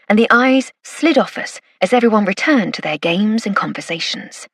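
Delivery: ordinary delivery, nothing marked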